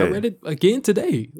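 very high-pitched insistence; squeaked-up, defensive objection; indignant protest. Real, not acted. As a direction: insist with the voice jumping way up. Protests being doubted by co-host.

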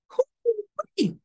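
high excited whoop; preacher's delighted hoo-wee. Real, not acted. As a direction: let out a joyful whoop. Exclamation in sermon.

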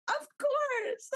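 very high squeaky reply; imitating a high bubbly answer; high giddy of course.

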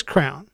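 "crown" in one syllable for crayon; wife's mispronunciation. Retold or imitated. Husband quoting wife, one-syllable mispronunciation. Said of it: "but she says".

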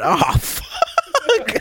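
very high, squeaky mock dismay; imitated exclamation. Friend echoing the robbed kid's reaction, squeaky mock dismay. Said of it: "He said".

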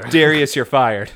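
loud, blunt dismissal; mock firing. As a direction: fire him at full volume, playfully.

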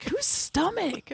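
very slow, high puzzled whose; high drawn-out question. Retold or imitated. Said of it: "Someone's like".

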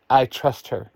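plain reading voice

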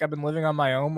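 ordinary delivery

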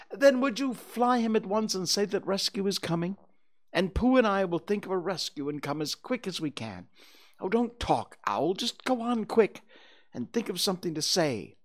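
put-on high-pitched boy giving orders; impatient, urgent boy. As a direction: hurry Owl along.

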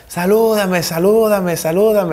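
high repeated mock call; imitated fan calling. Fan begging player to say hello, imitated calling. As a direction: pester like a fan calling from afar. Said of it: "me gritan por ahí en un estadio".

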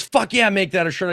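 high, loud eager yes; imitating a thrilled buddy; hyped friend. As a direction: cheer the idea on, fired up. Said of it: "he goes".

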